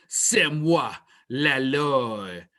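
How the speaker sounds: a hint of French, said with an English accent; clumsy French. Co-host tries his French on the podcast, clumsy French accent. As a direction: try out the phrase in shaky schoolboy French. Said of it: "I'm gonna try my French".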